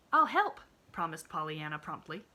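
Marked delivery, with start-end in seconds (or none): 0.1-0.6 put-on bright, high little girl voice; eager child reassuring her friend; cheerful little girl. A narrator's reading. Pollyanna voiced in story.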